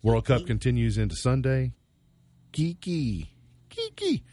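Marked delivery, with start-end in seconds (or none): 2.5-3.3 playful name call. Pretend. Host playing with singer [name]'s name, playful call. 3.7-4.2 very high falsetto squeak; squeaky name call. Pretend. Host playing with singer [name]'s name, falsetto squeak.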